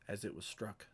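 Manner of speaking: ordinary talk, nothing marked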